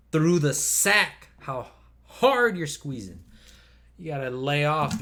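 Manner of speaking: pained plea. Retold or imitated. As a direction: drag it out like it hurts. Acted quote of a business partner.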